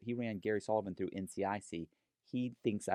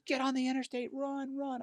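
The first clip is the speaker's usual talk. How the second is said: urging Becky. Retold or imitated. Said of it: "and she goes".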